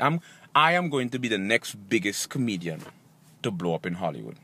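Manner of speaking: very deep, soft speech voice; self-assured declaration. Acted. Comedian predicting his Hollywood rise, deep self-assured voice. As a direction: say it very low, soft and sure. Staged confidence speech, first take.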